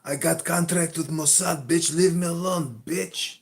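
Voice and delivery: loud, high-pitched angry rant; angry retort. Acted. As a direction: play a worked-up hothead yelling back. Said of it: "you're kinda angry right now".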